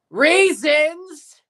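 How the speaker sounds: sudden loud, high angry bark of the word; angry shout. Real, not acted. Streamer barks the team name out angrily. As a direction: snap it out like you're furious. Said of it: "I don't know why I said that so angrily".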